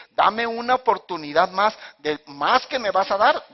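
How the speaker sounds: loud, slow, high asking; emphatic request. Acted. Samson's hypothetical bigger prayer, slow loud emphasis. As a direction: spell out the bold request loudly. The better prayer, re-voiced with force.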